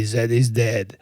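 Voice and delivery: a hint of a low, gruff boyfriend; lightly voiced Bruce Willis tough guy; Pulp Fiction tough guy. Boyfriend correcting his girlfriend, lightly gruff. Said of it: "That's, that's Pulp Fiction".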